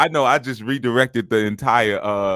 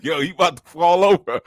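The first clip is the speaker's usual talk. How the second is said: raised, amused play-by-play of a wobble; retold alarm; 9 semitones higher, 6 dB louder than usual. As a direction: retell it high and animated. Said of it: "I was like".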